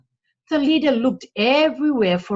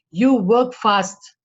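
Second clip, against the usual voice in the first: slow, louder thief leader; a hint of gruff leader; 2 semitones higher, 7 dB louder, slower than usual. A narrator's reading. Thief leader to the carpenter, slow and louder. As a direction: weigh him up slowly. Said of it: "said the leader".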